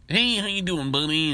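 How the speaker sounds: high, chummy sales greeting; mock top salesman greeting; pushy salesman. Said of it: "They're like".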